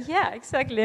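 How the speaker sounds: bright, eager yeah exactly; excited agreement. Retold or imitated. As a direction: playfully imitate their delight. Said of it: "And we were like".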